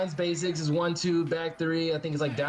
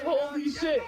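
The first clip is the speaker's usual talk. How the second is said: retold shock; 7 semitones higher, slower than usual. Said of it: "I'm like".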